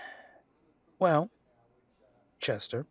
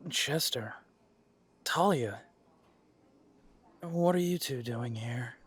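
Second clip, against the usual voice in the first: ordinary talk, nothing marked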